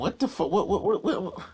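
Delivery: spluttering what what what in shock; stunned what. Real, not acted. Hearing the video threaten him, he splutters in disbelief.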